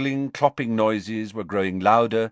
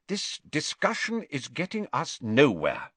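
firm; 7 semitones higher than usual. A narrator's reading. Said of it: "said Ogden firmly".

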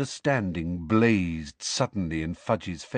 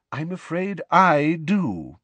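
higher, slow, polite regret; apologetic; 7 semitones higher, slower than usual. A narrator's reading. Headmaster contradicting the minister, apologetically. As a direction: contradict him with mild regret. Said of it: "said Dumbledore apologetically".

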